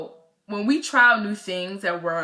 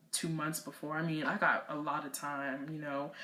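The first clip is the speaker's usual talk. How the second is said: low, soft grumbling to oneself; retold doubtful thought, dropped very soft; reluctant inner voice; 4 semitones lower, 11 dB quieter than usual. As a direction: think it aloud quietly, unconvinced. Acting out her reluctant inner thoughts.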